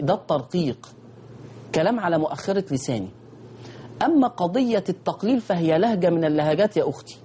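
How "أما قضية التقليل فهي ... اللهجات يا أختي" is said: normal talking voice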